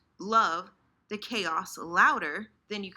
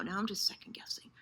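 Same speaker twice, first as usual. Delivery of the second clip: whisper; 4 dB quieter, faster than usual. Retold or imitated. Anxious inner voice repeated.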